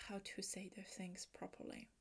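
ordinary talk, nothing marked

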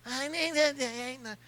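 soft, slow pleading impression of his wife; a terrible soft slow imitation of his wife. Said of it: "terrible impersonation of her".

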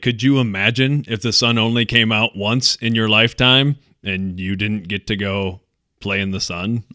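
usual speaking voice

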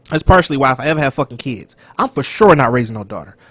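ordinary talk

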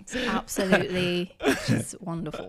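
put-on light English accent, gushing with a laugh. Praising The Crown in an English accent. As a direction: rave about the show like a Brit. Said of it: "you got a pretty good accent on that".

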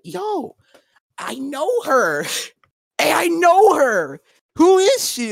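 high, drawn-out mock girl voice. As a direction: squeak her words up like a clueless teen. Racist girl quoted in high voice.

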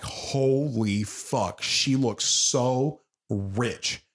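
very slow, drawn-out amazement; imitating a slow, dawning realization; mock awe. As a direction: stretch each word as if awestruck. Acts out a character's awed reaction.

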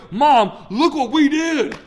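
put-on high, loud, beaming kid voice; proud young sons. The boys show Mom their work with a proud, high kid voice. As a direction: boast like proud little boys. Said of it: "they go".

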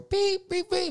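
high, loud mimicked beeps; microwave beeps. [name] warming pizza, high loud microwave beeps. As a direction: beep it like a microwave.

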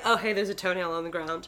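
casual. Acted. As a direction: toss it off like a casual observation. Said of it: "I like the casualness".

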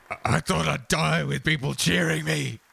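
wounded fighter's lament. Acted. Killington realising the crowd hates him, pained high lament. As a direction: lament it high, stretching the last words. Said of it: "he just goes".

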